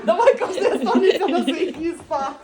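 loud, laughing voiced dog reply; dog's voice. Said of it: "таким взглядом такий". (голос собаки зі сміхом)

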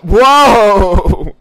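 high, loud drawn-out whoa; amazed whoa. Real, not acted. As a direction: gasp out a long stunned whoa. Stunned at game rules.

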